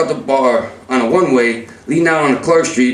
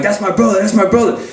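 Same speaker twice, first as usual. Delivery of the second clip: higher-pitched retold alarm; alarmed insistence; 4 semitones higher than usual. Telling buddies it's his brother, alarmed.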